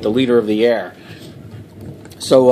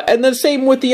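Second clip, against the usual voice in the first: mock outrage; 12 semitones higher than usual. Continues outrage.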